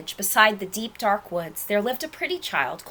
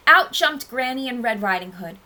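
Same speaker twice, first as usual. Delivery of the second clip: usual speaking voice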